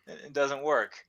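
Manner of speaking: normal talking voice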